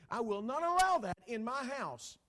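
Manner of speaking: loud, high domineering outburst; mock intimidating roar; threatening bully. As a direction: lay down the law like a household bully. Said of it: "that's like threatening a companion".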